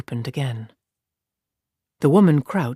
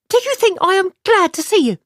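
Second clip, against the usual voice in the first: much higher, louder scornful question; scornful woman; 4 dB louder than usual. Acted. Aludra sneering at Tammuz, high and scornful. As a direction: throw it at him with contempt.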